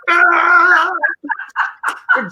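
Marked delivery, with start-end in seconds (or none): 0.1-0.9 high, loud "Ah!" of panic; imitated scream. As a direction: scream like a rider in a death wobble. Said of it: "she's like".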